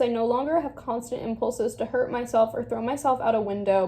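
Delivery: ordinary talk, nothing marked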